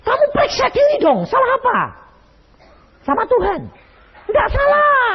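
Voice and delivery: very high, pressing retort; heated argument. Retold or imitated. Husband arguing with wife on the phone, high heated retort. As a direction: push her to examine herself.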